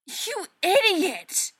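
high, loud, slow yell of rage; furious shout. Acted. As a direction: scream it at him, furious. Sister explodes at brother.

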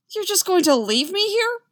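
loud, high, shrill protest. A narrator's reading. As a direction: rise to a shrill, panicky question. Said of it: "a little shrill".

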